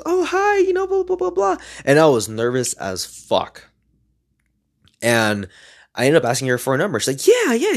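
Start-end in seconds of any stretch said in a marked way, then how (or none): 0.0-1.6 very high mock girl's voice; blushing girl. Blushing girl answering him at the coffee shop, mock high voice. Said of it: "she, like, started blushing. She goes". 7.3-7.8 very high imitated girl's delight; squeal-high mock eager agreement; so happy. Happy girl agreeing to give her number, excited imitation. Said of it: "She was so happy".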